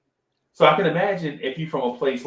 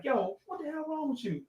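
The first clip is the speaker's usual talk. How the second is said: high, soft put-on wife voice; wife scolding husband, lightly voiced high and soft; imitating his wife; 13 semitones higher, 9 dB quieter than usual. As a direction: do your wife's baffled reaction lightly. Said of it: "my wife is like".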